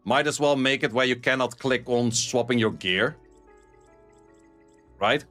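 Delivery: normal talking voice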